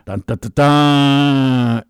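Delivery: mock ominous dun dun. Ominous sound effect joke.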